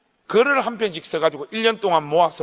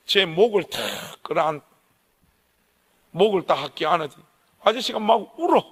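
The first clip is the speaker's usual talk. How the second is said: normal talking voice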